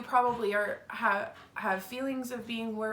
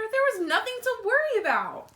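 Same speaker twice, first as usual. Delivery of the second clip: higher, louder upbeat reassurance; cheerful mother; 12 semitones higher, 7 dB louder than usual. Acted. Mother reassuring Wimberly at her party, bright and higher. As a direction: brightly tell her there was nothing to fear.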